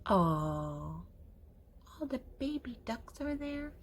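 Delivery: ordinary talk, nothing marked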